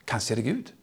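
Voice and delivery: a soft, breathy realization; hushed wonder. Acted. Eli wonders quietly if it is God. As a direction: whisper the thought to yourself.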